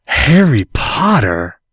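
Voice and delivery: slow, stunned gasped question. Acted. Draco to Harry, gasping in surprise. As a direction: gasp out the name in shock. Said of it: "gasped Draco".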